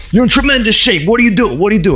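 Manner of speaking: high, amazed and insistent; Steranko impression. Comic artist admiring a fan's shape, amazed high voice. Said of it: "he goes".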